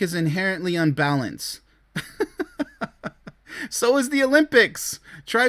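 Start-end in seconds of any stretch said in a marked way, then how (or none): 1.9-3.3 a voiceless, high burst of disbelieving laughter; silent scoffing laugh. Real, not acted. Reacting to the show's argument, silent scoffing laugh. Scoffs at the dating show claim.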